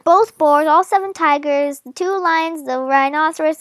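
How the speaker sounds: ordinary delivery, nothing marked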